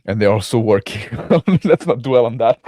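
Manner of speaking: loud, breaking into laughter mid-sentence; laughing while talking. Real, not acted. Podcaster announcing games to co-host, cracking up. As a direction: try to move on through a laugh.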